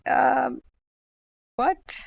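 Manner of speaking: high, loud rising what; stunned disbelief. Retold or imitated. Streamer hearing chat claim her age, stunned rising disbelief. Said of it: "And I went".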